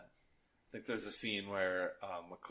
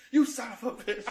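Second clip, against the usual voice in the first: laughing mock yell, much higher and louder; retold yell; 14 semitones higher, 7 dB louder than usual. A nephew retells his uncle yelling at his dad's putt, laughing mock yell. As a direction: shout it like a goofy uncle heckling a putt, through laughs. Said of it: "He'd be like".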